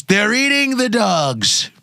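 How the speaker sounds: high, slow, drawn-out Trump voice; slow hammed-up Trump rant; Trump impression. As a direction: do Trump ranting at the debate. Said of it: "He just goes".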